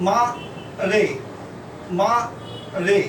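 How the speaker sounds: মা রে split into two slow beats, open আ as in মাছের; syllable by syllable. Shown as a demonstration. Teacher to dictation class, spelling out the name syllable by syllable. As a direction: sound out মা then রে with a long আ. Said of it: "মা, মাছের আ".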